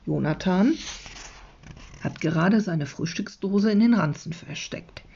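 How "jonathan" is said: usual speaking voice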